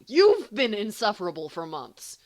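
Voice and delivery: loud tit-for-tat comeback; accusing retort. Acted. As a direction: hurl the words right back. Thrown back accusation.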